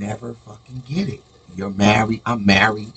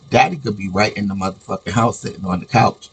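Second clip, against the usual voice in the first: ordinary talk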